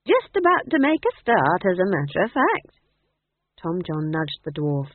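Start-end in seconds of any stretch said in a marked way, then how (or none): 0.0-2.8 put-on high, lilting cheery old-woman voice; breezy, swooping and loud; cheerful. A narrator's reading. Old woman chatting on to the lad, cheerful. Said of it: "said Nanny cheerfully".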